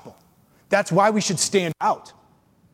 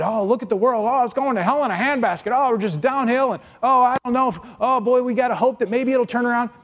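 mock doom lament; 6 semitones higher than usual. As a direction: wail about the world going downhill. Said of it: "Don't be shocked at".

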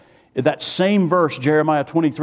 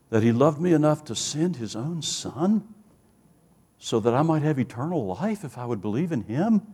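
softer, with pauses to let it sink in; hushed testimony; 2 semitones lower, 5 dB quieter than usual. Retold or imitated. Convert telling the conference of his turning point, hushed. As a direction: retell the turning point quietly, unhurried.

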